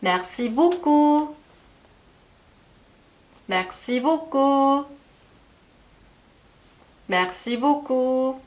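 Merci beaucoup very slow, stress on beaucoup; drawn-out Merci beaucoup with gaps to echo; teacher models Merci beaucoup slowly; model for repeating. Shown as a demonstration. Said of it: "한번 따라해 볼게요".

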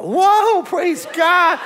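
high, loud burst of amazement; re-enacted revival whoop; jubilant shout. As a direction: cry out in astonished praise. Reacting to the dog moving.

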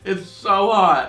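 spicy burn. Real, not acted. Spicy pepper burning mouth.

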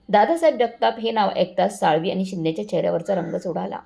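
plain reading voice

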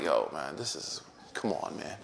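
giving up, muttering under his breath; exasperated. Retold or imitated. Scholar fed up with the woman's verses, low soft exasperation.

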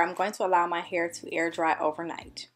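ordinary talk, nothing marked

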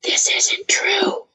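hushed, breathless whisper; husky low whisper. Retold or imitated. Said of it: "saying in a husky low voice".

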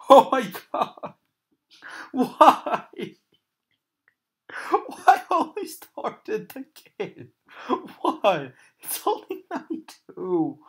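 high panicked wailing; jump scare laugh. Real, not acted. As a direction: cry out in panic. Panic at the game.

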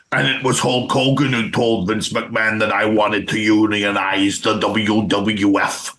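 imitated ex-wrestler voice, slowing at the end; Jesse Ventura. Host as ex-governor blaming Hulk Hogan, impersonated. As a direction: put on the old wrestler's grievance. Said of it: "Ventura is his own kettle of fish".

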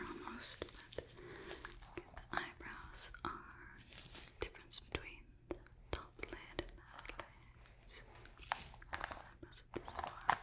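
very soft, whispered note-taking; ASMR whisper. Acted. Examiner noting eyebrows on a surprised face, hushed ASMR whisper. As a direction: whisper the findings under your breath. Roleplay examiner murmurs measurements.

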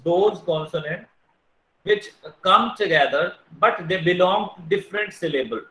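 deliberate teacher pace; slow dictation. Shown as a demonstration. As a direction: dictate it so they can write it. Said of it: "dictating definition".

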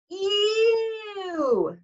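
high, loud squeal of disgust; a girl recoiling from a gross story. Acted. Girl behind Percy reacting to barfing, squealing disgust.

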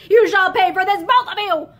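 loud furious echo of the threat; recalled threat. A narrator's reading. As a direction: hurl the threat again.

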